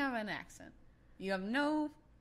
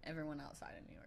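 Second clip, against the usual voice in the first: whisper; 4 semitones lower, 10 dB quieter, faster than usual. Real, not acted. Joking jab at New York.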